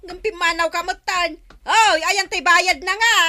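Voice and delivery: high, loud shout after someone running off; calling after a customer who walked off without paying; shouting after him. Acted. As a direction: yell for him to come back and pay. Shouting after fleeing buyer.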